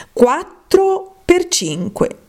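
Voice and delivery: slow, high sing-song times table; slow recitation. Acted. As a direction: drag out the sum like a schoolgirl reciting. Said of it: "Proviamo un po'". (tabellina recitata lenta e cantilenante)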